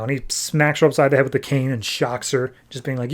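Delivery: ordinary delivery, nothing marked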